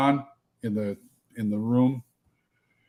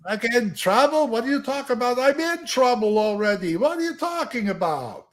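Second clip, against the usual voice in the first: put-on high-pitched Yiddish-accented complaint; 10 semitones higher than usual. Hobbit protesting he's in trouble already, high Yiddish-accented voice. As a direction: kvetch in a much higher Yiddish-style voice.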